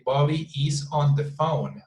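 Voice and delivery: BOBBY IS ON THE PHONE in separate words; careful, unlinked BOBBY IS ON. Shown as a demonstration. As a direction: keep IS and ON apart, fully pronounced. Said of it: "we don't produce word by word".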